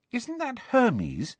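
slow, high, wondering question; amazed. A narrator's reading. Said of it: "sounding amazed".

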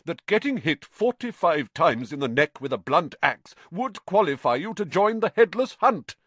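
rising outrage at the insult; indignant outburst. A narrator's reading.

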